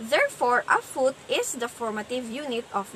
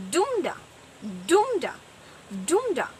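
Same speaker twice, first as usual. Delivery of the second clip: strong-weak falling beat on each "dumda"; trochaic stress; 3 semitones higher than usual. Shown as a demonstration. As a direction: say "dumda" stressed on the first syllable, pausing after each. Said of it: "combination of stressed and unstressed".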